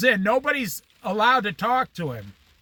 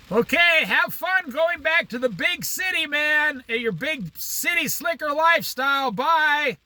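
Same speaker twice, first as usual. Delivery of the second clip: mock teasing farewell; 8 semitones higher than usual. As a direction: rib the boss as he drives off. Taunting the departing district manager.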